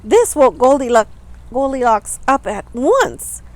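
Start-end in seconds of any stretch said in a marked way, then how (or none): none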